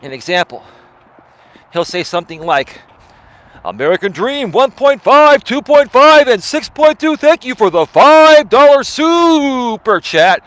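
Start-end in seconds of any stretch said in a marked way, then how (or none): 3.6-10.4 loud, high hyped-up radio voice; booming, hammed-up announcer read; fake DJ voice. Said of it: "use a certain tonality in his voice that's not really his own".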